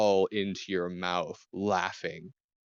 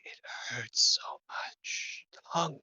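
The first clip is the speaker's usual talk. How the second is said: hungry ghoul's breathy whisper, rising at the end; eerie whisper; 7 semitones higher, 4 dB quieter than usual. Acted. Undead gnolls whisper their hunger at the bard from behind. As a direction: whisper it like something starving behind you. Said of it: "whispering at you".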